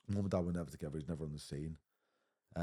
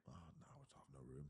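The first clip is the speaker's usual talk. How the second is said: a quiet, far-off mocking voice of the school; mimicking the school's brush-off; mock school official; 3 semitones lower, 19 dB quieter, faster than usual. Mocking the school that refused a mixed race boy, quiet mimicry. School turning them away, mocked.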